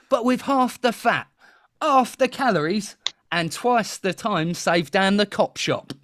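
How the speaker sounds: high, animated Cockney market patter; bad Cockney TV-advert voice; terrible Cockney accent. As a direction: pitch it up like a hammed-up Cockney salesman. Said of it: "that was my terrible Cockney accent".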